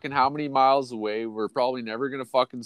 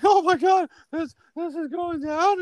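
very high falsetto squeal; mock shocked girl. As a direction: imitate a girl gasping in amazement. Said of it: "you're like".

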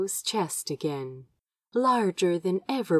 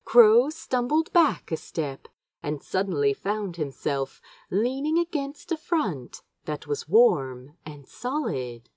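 usual speaking voice

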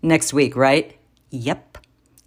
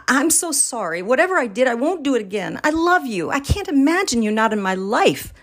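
higher, melodramatic begging to his wife; pleading; 8 semitones higher than usual. Acted. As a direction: overplay the desperate apology. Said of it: "I plead".

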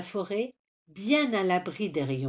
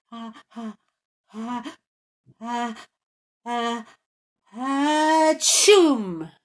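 ah ah building higher into a loud sneeze; 5 semitones higher, 6 dB louder, much slower than usual. A narrator's reading. Rooster sneezes beside sleeping trolls, building comic sneeze. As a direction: hold the sneeze back then explode. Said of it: "sent monter une terrible envie d'éternuer".

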